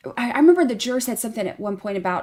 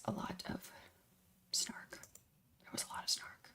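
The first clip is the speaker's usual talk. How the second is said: whisper; 21 dB quieter than usual. Acted. Whispered aside about snark.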